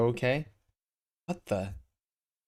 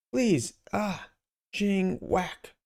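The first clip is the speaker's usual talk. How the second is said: victim's cry; 9 semitones higher, much slower than usual. Acted. Mugging victim voiced.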